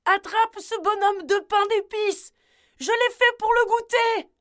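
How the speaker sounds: loud, very high cry for help; grandmother shouting. A narrator's reading. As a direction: yell urgently to your husband. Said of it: "Elle cria à son mari".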